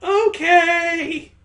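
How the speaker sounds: high, loud cheerful squeal; very high, loud excited cry; eager agreement. Acted. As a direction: chirp it out, thrilled to join in. Eagerly joins the kissing game.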